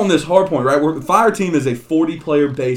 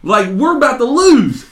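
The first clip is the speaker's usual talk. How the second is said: high-pitched irritated yell at a teammate; retold angry gamer outburst; pissed off; 11 semitones higher, 5 dB louder than usual. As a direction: bark it like a fed-up gamer urging a teammate on. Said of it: "I'm fucking getting pissed".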